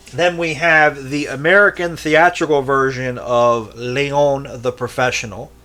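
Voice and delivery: normal talking voice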